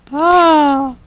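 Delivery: long high-pitched ahh; loud theatrical ahh. Pretend. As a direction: belt a silly high note.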